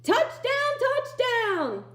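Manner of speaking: very high, loud victory cry; shrieked touchdown cheer; triumphant cheer. Acted. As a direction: celebrate the catch like a game winner.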